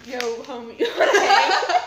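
laughing, hammed-up street greeting; mock twenties slang. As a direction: pitch it up through a laugh, playing a homie. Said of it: "I don't know how they talk".

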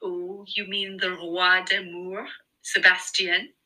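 slow, husky mock-French voice; exaggerated husky French accent. A narrator's reading. Friend teasing Eva about Sebastian, exaggerated husky French accent. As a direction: ham up a French accent, drawing it out slowly. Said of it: "imitating a husky French accent".

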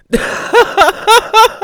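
high wheezy laugh. Real, not acted. Comic laughing at the wedding story, high wheezing laugh.

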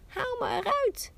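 high, loud cry for help from the oven; the bread pleads to be taken out; calling out. A narrator's reading. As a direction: loaf crying out to the passing girl, high and loud. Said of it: "riep het brood".

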